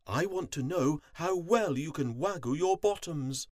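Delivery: high, grand queen addressing her subjects; queen bee declaring. A narrator's reading. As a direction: declare it like a ruler to the hive. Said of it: "on her throne and declared".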